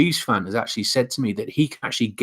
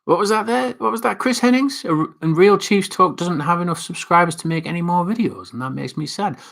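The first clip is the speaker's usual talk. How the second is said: much higher, put-on query and read-out; high-pitched mock voice; 8 semitones higher than usual. Vlogger reacting to Chris Hennings's comment, high mock voice. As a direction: pitch it way up in pretend surprise.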